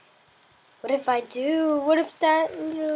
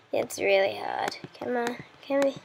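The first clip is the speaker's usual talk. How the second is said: ordinary talk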